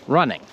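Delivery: running with a faint soft G; teacher modelling a barely there G at the end. Shown as a demonstration. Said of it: "a little bit of a G".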